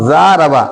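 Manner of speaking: ज़ारबा with two counts on ज़ा, one on रा and बा; long ज़ा, then short रबा in ज़ारबा; single-count ending. Shown as a demonstration. Teacher shows double then single counts, ज़ारबा with short रबा. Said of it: "यहाँ single single".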